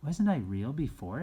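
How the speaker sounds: a hint of the little rabbit; little rabbit asking. A narrator's reading. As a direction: ask it timidly as the toy rabbit. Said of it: "asked the little rabbit".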